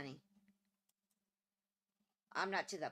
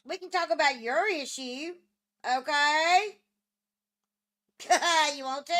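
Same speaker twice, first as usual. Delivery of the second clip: loud, high call; 6 semitones higher, 12 dB louder than usual. Real, not acted. Calling to someone in another room, loud. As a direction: call it across the house.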